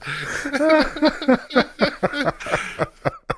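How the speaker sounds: long high-pitched laugh; high laughter. Real, not acted. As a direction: crack up laughing. Laughing hard at the story.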